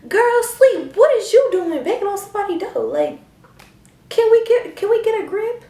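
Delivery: high, loud, exasperated yell; loud mock yell at mom; exasperated shout. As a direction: shout it in disbelief. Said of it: "You know what she said to me".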